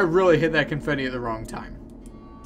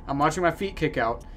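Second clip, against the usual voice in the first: normal talking voice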